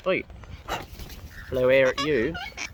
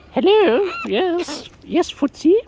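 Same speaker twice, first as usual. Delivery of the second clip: high, louder sing-song greeting to a bird; sing-song hello; 12 semitones higher, 6 dB louder than usual. Real, not acted. Greeting a wild bird, high louder sing-song. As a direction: coo a bright hello up high.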